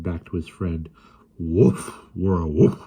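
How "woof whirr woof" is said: growl. A narrator's reading. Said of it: "he said with a growl".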